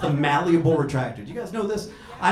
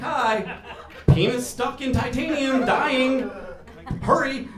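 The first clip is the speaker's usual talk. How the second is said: higher-pitched, comic emergency call; mock urgent page; 8 semitones higher than usual. Surgeon pages pathology at night, mock urgent page. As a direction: play a hurried page in a raised pitch.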